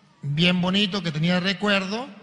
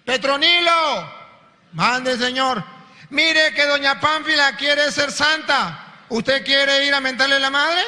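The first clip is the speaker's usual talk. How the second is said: higher, louder, slow call from on high; preacher voicing God, loud and high; booming divine call; 7 semitones higher, 4 dB louder, slower than usual. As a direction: call out like God summoning a servant. God addressing Petronilo.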